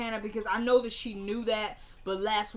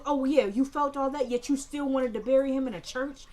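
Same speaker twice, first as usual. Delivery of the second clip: louder, higher mocking jab; imitating a sneering retort; sarcastic challenge; 6 semitones higher, 7 dB louder than usual. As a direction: throw her own feelings back at her.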